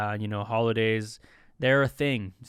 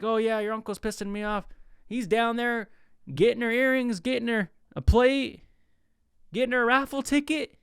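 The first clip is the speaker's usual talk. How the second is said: higher-pitched mock auntie grievance; complaining; 8 semitones higher than usual. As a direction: list his errands like a put-upon auntie, voice raised in pitch. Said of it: "Auntie's complaining about their man".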